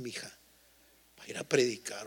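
ordinary talk, nothing marked

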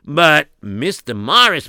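ordinary delivery

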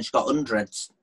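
ordinary talk

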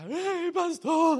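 high-pitched mock wail; hammed-up tearful plea; imitating crying. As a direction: whine it like a tearful churchgoer seeking comfort. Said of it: "Si a mí me vienen llorando".

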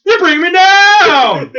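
high, loud drawn-out cartoon complaint; Space Ghost whining. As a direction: wail it like a sulking superhero. Repeating Space Ghost line.

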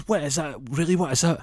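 high, insistent questioning; acted-out desperate need to know; exasperated demand. Demands what rival has.

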